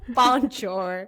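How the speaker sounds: mock French in a broad American accent; playful American-accented French. Shown as a demonstration. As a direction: stretch it out with hard American sounds. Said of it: "Sounds so American".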